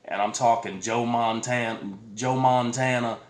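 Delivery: ordinary talk, nothing marked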